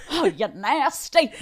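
lightly mocked sitcom catchphrase, drawn out; That's So Raven. Joking about a sudden vision moment, playful impression. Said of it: "Was it like a That's So Raven".

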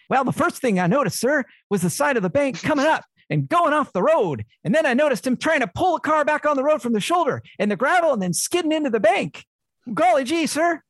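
put-on high, earnest aw-shucks boy voice; wholesome 1950s kid. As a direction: play it like a gee-whiz farm kid on the stand. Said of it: "his Tiny Tim-like inability to walk".

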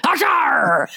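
high, explosive pirate arr; pirate sneeze. Retold or imitated. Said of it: "sneeze today like they were a pirate".

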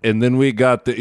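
usual speaking voice

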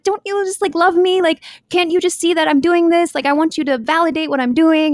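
high-pitched mock pleading. Swimmer begging caregivers for validation, mock pleading. Voicing a plea for love.

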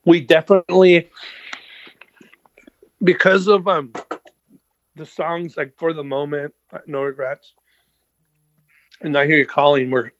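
talking with food in mouth; mouth full. Real, not acted. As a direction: speak around a mouthful of food. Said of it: "was talking with food in my mouth".

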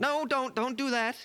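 high, panicked plea; alarmed protest. Acted. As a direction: beg them to stop, voice shooting up. Trying to stop an attack.

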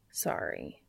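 soft low murmur. A narrator's reading. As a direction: murmur the apology gently. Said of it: "Castiel murmurs".

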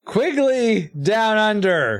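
Quigley Down Under said high, slow and emphatic; each word of Quigley Down Under stretched out; insistent correction. Real, not acted. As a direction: spell out Quigley Down Under as if exasperated. Insisting on the right film.

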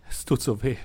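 voiced, tearful pained moan. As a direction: moan it out through tears. Same words voiced earlier.

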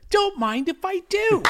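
high squeaky stooge voice; gleeful high cartoon accept; Curly voice. Voicing Belushi doing Curly.

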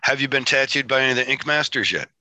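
ordinary talk

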